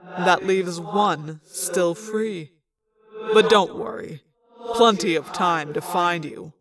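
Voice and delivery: low, slow, soft menacing voice; Visser Three gloating. A narrator's reading. Visser Three taunting the one still free, low slow menace. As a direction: gloat over your captives slowly. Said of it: "Visser Three said".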